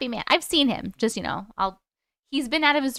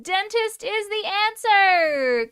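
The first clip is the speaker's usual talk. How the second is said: drawn-out reveal; 8 semitones higher, 3 dB louder, much slower than usual. Real, not acted. Revealing the answer.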